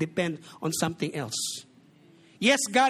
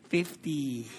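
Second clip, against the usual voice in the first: a touch of a curt, unimpressed vendor, lower; low flat vendor reply; 7 semitones lower, 4 dB quieter than usual. Retold or imitated. Vendor with a gold tooth answering the boastful buyer, lower and curt. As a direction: drop the price low and dry, lightly voiced. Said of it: "Sabi niya".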